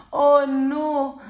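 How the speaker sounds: loud oh no over the spilled milk; mock dismay. Host reacts to Leo spilling the milk, loud mock dismay. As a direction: play up the shock at the spill.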